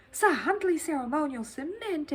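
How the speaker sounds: surprised echo. Acted. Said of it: "echoed Paddington, looking most surprised".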